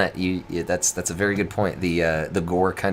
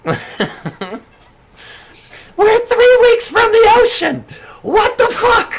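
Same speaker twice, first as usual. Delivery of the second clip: high, loud, exasperated Costanza-style yelling; George Costanza; 10 dB louder than usual. Acted. Manager yelling at his employee in disbelief. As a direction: whine it out high like a fed-up boss. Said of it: "this is George Costanza, your manager".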